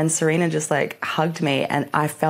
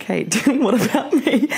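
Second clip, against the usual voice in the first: laughing; 8 semitones higher, 3 dB louder, slower than usual. Retold or imitated.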